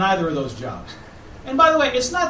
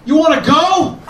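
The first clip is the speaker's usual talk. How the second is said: higher, louder mock-aggressive shout; intense Boston slogan; 8 semitones higher, 7 dB louder, slower than usual. Boston's travel slogan as a mock-aggressive shout. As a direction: bark it like a picking-a-fight local. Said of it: "Boston should be intense to match our personality".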